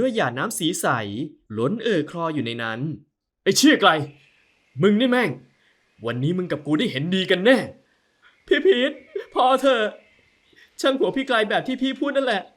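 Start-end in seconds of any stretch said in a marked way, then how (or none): 3.4-7.6 loud, higher cursing shout; furious outburst. Acted. Angry man cursing Krai, loud furious shout. 8.5-12.4 high, loud pleading cry; distraught young woman. Acted. Wife stopping Pete's fight, high loud plea.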